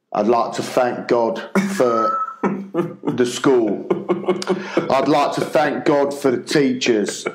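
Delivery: slow, halting prayer. Retold or imitated. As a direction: drag out a panicked prayer. Panicked improvised prayer.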